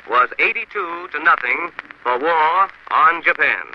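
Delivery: slow emphatic read. Real, not acted. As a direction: slowly weigh each word of the vote. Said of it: "I'll repeat that".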